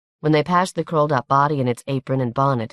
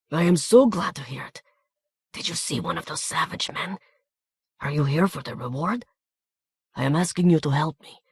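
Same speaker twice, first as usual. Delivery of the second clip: anxious little Hungarian, hushed and glad; Hungarian accent. A narrator's reading. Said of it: "with a harsh Hungarian accent".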